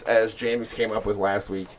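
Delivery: ordinary talk, nothing marked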